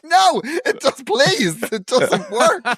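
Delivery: high, drawn-out mock pleading; comic wail of helpless protest; desperate protest. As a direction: plead in a hammed-up, high-pitched panic. Acting protest of stung victim.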